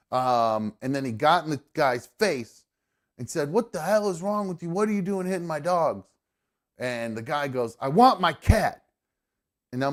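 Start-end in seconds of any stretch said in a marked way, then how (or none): 3.5-6.1 retold angry scream. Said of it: "And he is screaming". 7.8-8.8 high, loud mock demand; retold insistent demand. Retelling stranger's angry demand.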